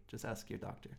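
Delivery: whispered aside, almost no voice; whispering. Real, not acted. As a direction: breathe it like a secret. Repeats the advice as whispered aside.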